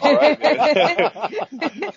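high, loud, stretched out; hammed-up booker. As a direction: booker agreeing, drawn-out and high.

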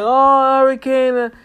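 mock clueless surprise. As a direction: play dumb like it's news. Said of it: "why act like we so clueless".

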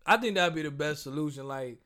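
ordinary talk, nothing marked

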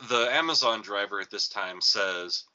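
usual speaking voice

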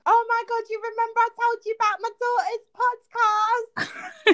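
high, excited Bletchley mum impression; Bletchley accent. Mom bragging to her employee about the podcast, Bletchley accent. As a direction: boast loudly in broad Bletchley accent. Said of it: "in her best Bletchley accent".

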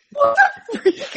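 high, laughing startled yelp; startled exclamation. Retold or imitated. Friend retelling being crept up on at night, startled. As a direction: jump and squeal it. Startled by sleepwalker behind her.

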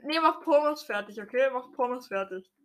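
ordinary talk, nothing marked

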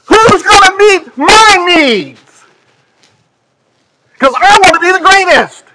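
mock them like spoiled children; whiny apostles. Mocking the apostles' selfishness.

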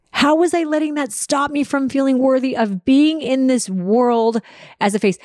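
rising disbelief. Retold or imitated. Self-questioning outburst about thinning hair.

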